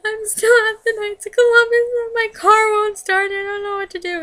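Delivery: loud, high-pitched mock wail; imitated crying. Stranded caller telling a friend the car won't start, imitated crying. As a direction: wail it out as a retold tearful panic. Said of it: "crying at this point".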